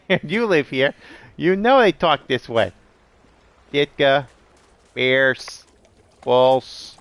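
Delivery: high, hammed-up Chicago Bears fan accent. Shown as a demonstration. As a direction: play an emphatic Chicago sports fan. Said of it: "This is how they talk".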